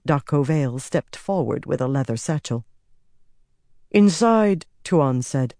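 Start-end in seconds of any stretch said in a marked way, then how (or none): 3.9-4.7 a lightly voiced empress, cool and certain; commanding empress. A narrator's reading. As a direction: lay down the law from the throne. Empress presents the satchel.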